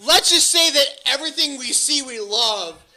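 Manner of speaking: ordinary talk, nothing marked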